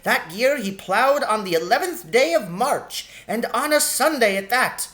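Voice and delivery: a tiny sharp-tongued shrew, pitched high; sharp lady shrew. Acted. Shrew recalls early plowing.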